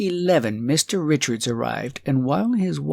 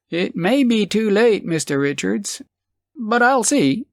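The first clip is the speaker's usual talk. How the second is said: editor voice; 8 semitones higher than usual. Acted. Editor answers Richards.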